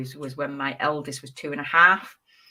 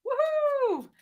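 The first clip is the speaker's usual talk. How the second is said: excited cheer. Real, not acted. Reveal cheer.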